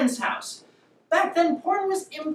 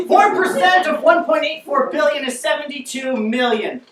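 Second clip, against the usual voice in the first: loud, higher, emphatic math answer; exasperated answer; 4 semitones higher, 6 dB louder than usual. Acted. Answers after crowd fails math.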